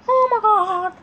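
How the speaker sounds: exasperated outburst. Real, not acted. Failing to share the video, exasperated outburst. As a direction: blurt it out, pitch shooting up.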